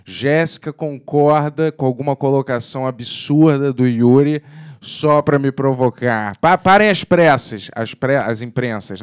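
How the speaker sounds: loud, high mock newsboy proclamation; breaking-news crier. Announcing a partner's verdict as breaking news, mock proclamation. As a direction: shout it out like a stop-the-press bulletin.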